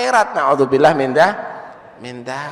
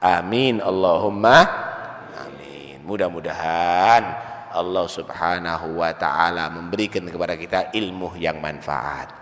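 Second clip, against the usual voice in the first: closing prayer; 8 semitones lower than usual. Real, not acted. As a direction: pray over the congregation calmly and low. (doa penutup dengan suara rendah dan lembut)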